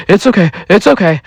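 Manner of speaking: quick, high-pitched soothing; acted hurried comforting; frantic reassurance. Woman soothing wounded man.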